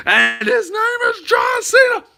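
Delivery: very high-pitched mock announcer shout; hammed-up ring introduction; wrestling announcer.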